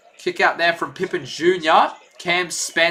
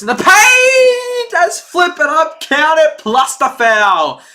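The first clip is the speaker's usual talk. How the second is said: louder and higher, calling the and-one hard; excited shout; 9 semitones higher, 6 dB louder, slower than usual. Real, not acted. Streamer to his chat, hyping the and-one with an excited shout. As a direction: yell it like the crowd just rose.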